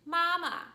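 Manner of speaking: "mama" starting on a high, level pitch; high-held first syllable of "mama". Shown as a demonstration. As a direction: say "mama" high, loud and even. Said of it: "was actually the word for mother".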